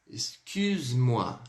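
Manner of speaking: "Excuse-moi" drawn out very slowly, pouted u; slow demo. Shown as a demonstration. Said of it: "Então vamos lá". ("Excuse-moi" bem devagar, com biquinho)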